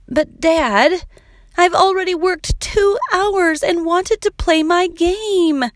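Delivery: put-on high-pitched child's protest; arguing. A narrator's reading. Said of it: "she began to argue".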